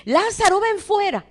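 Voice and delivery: loud, high-pitched summons; commanding call. Acted. Jesus calls Lázaro out of the tomb, commanding shout.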